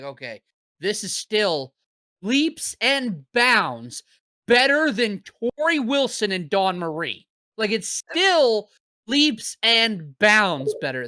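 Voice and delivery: LEAPS and BOUNDS drawn out, high and loud; emphatic insistence. Real, not acted. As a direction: punch leaps and bounds, stretching each word. Emphatic praise of the match.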